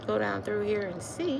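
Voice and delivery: normal talking voice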